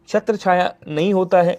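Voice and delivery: normal talking voice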